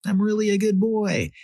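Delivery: put-on high-pitched excited little kid voice; screaming little boy. A narrator's reading. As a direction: pipe up high like a small boy. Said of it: "I ran, screaming across the house".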